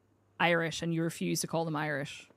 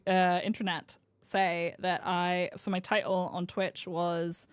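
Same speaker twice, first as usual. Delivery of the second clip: ordinary talk, nothing marked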